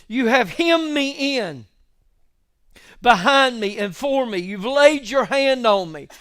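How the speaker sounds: full-force pulpit delivery; fervent preaching. Acted. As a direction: preach it out to the room. Preacher reading psalm with fervour.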